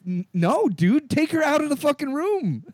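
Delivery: stammer, then high-pitched exasperation; exasperated demand. Retold or imitated. Moviegoer telling dad to leave, high exasperated retort. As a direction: climb high in mock indignation.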